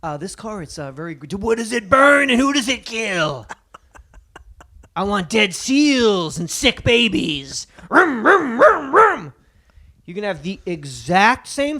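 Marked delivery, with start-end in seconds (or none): 0.0-9.3 mock gas-guzzler fan. Joking about car buyers, a hammed-up gearhead voice. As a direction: sneer it out like a proud polluter, revving at the end.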